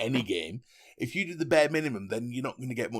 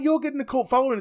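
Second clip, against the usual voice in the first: high, raised mock voice; imitated commenters; 13 semitones higher, 6 dB louder, faster than usual. Host retelling the comments to his co-host, high mock voice. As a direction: push it up high and louder, imitating. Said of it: "And it's like".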